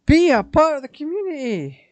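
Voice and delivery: emphatic. Real, not acted. Urging developers.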